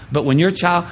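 usual speaking voice

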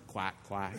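low mocking quack, quack; duck quacks. Preacher voicing the devil as a duck, low mocking quack.

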